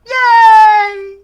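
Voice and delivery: put-on very high, loud child cheer; piercing high-pitched boyish shout; delighted little boy. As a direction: squeal it like an overjoyed kid. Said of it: "There's the little boy".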